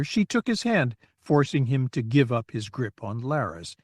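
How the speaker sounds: plain reading voice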